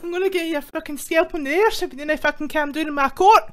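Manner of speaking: loud fast laughing rant. Real, not acted. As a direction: rant while cracking up.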